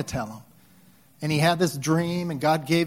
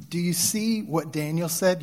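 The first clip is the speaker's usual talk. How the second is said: ordinary talk, nothing marked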